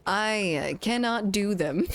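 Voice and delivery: ordinary talk, nothing marked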